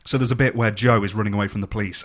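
normal talking voice

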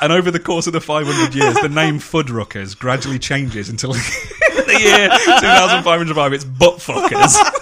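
slow, through giggles; laughing while talking. Real, not acted. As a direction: laugh your way to the punchline. Retelling a favourite joke, laughing.

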